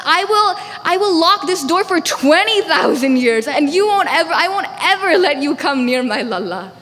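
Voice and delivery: loud, high, emphatic retort; fierce defiance. Acted. Stubborn mother vowing to lock out the visitor, fiercely defiant. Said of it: "Yashoda Mai is nothing less".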